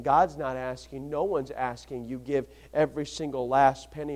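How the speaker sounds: soft, low intimate preaching; hushed preaching. Acted. Preacher leaning in to the congregation, soft and hushed. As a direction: draw them in with a near-whisper.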